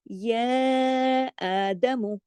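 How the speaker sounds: या held long before आदमु, slow; stretched या. Shown as a demonstration. Teacher shows the long vowel to students, slow. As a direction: pull the या out. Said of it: "या खड़ा जबर है ... खींच के पढ़ेंगे".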